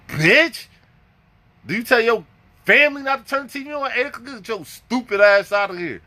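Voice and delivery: high-pitched mock outrage; outraged comeback. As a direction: act out an incredulous telling-off. Retells his retort to the officer.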